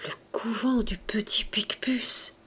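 soft, half-whispered musing; hushed, thoughtful murmur; talking to himself. A narrator's reading. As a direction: repeat it under your breath, absorbed. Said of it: "comme se parlant à lui-même".

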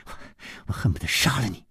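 strained, rasping fury; seething, hoarse threat; hoarse hiss. A narrator's reading. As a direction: choke it out hoarsely through hatred. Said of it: "她嘶声说".

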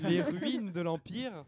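slow, grand movie-trailer announcement; dramatic title voice. Shown as a demonstration.

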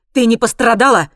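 worried shout across; loud worried call. A narrator's reading. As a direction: call out loudly in worry. Brother shouts worried question.

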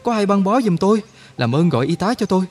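panicked shout. A narrator's reading. Wounded soldier panics over his head wound, high frantic shout. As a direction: cry out in alarm at the hole in his head. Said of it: "giật mình sờ tay lên trán và la lớn".

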